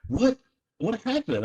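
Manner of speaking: mock anxious wife; imitating his wife. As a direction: play the worried wife, pitched well up. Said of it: "Paige is like".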